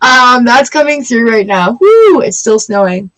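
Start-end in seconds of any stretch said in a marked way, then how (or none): none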